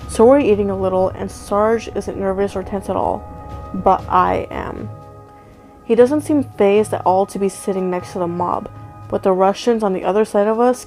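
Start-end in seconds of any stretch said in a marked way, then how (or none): none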